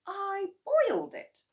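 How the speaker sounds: put-on high boyish voice; boy's voice. A narrator's reading. George answering his father, high boyish voice. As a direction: speak up like a young boy.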